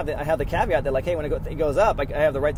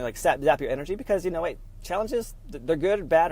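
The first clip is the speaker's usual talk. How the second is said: ordinary talk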